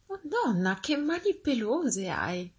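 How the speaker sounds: put-on high, drawn-out child's wonder; high, astonished child voice; amazed little girl. A narrator's reading. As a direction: slowly marvel as a storybook girl. Girl marvels at disguised wolf.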